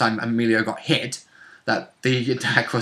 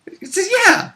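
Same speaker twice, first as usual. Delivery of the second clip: high mock voice; slower than usual.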